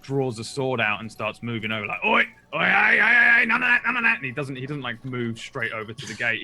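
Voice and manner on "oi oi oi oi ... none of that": high, loud guard shouting oi; guard yelling. Acted. Guard yells at the prisoner to stop, loud and high.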